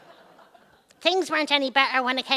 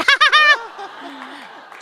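1 laughing; 8 semitones higher, 8 dB louder than usual. Acted.

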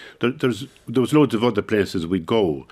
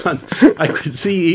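laughing; 8 semitones higher, 4 dB louder, slower than usual. Real, not acted. Laughing after the impression.

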